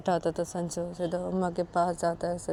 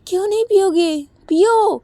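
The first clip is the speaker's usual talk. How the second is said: much higher and louder, shouting angrily; furious taunt; 8 semitones higher, 10 dB louder than usual. Acted. Uma angrily shouts at Sanju to smoke. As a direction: yell at him to go on and smoke then. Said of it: "तो तभी उमा बोलती".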